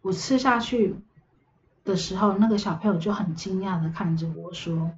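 ordinary delivery

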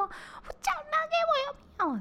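brother's demand pitched very high, yelled on 叫; imitating a yell. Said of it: "我怕我太大声". (模仿弟弟高声吼叫)

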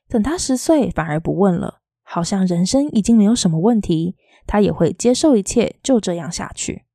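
usual speaking voice

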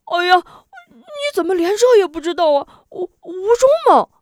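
high, loud, near-wailing frustration; almost sobbing with impatience; exasperated cry. A narrator's reading. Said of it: "龙彪发急道". (急得带哭腔的高声喊)

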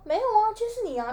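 sing-song tease, mimicking the brother; mocking kid brother. Imitating the brother's teasing reply. (模仿弟弟，高音挑衅)

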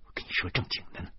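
quick, near-whispered rebuke; hushed seriousness. A narrator's reading. Wife telling her husband she is serious, hushed. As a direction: say it fast under the breath.

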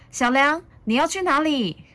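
high, loud, urgent call; mother calling out. A narrator's reading. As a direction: call after your child in worry. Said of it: "妈妈叫着".

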